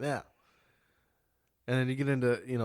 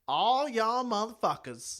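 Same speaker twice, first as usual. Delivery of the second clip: slow, high-pitched Southern drawl; imitated Southern twang; 11 semitones higher, 4 dB louder than usual. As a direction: stretch it out in a mock Southern twang.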